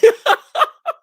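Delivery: high, loud burst of laughter. Real, not acted. Host laughing at the jobless contestant, loud burst. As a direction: crack up loudly.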